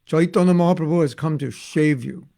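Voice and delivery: put-on loud Bengali-accented English proclamation. Imitating Prabhupada for listeners, Bengali English accent. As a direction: Bengali vowels, "save" said as "shave". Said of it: "do a Bengali English accent".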